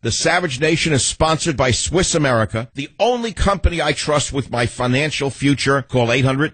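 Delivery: brisk radio sponsor read; upbeat host ad spot. Acted. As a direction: sell it like a live read.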